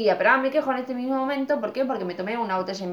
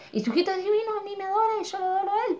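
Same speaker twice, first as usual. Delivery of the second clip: doting gush; 11 semitones higher, 3 dB quieter, faster than usual. Real, not acted. Gushing about neighbour's little boy.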